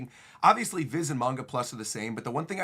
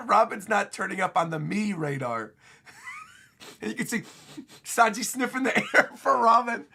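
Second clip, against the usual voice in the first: cracking up while talking; words broken up by giggles, higher; laughing; 7 semitones higher, 4 dB louder than usual. Real, not acted. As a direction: tell it through real laughter. Laughs while describing Sanji's gag.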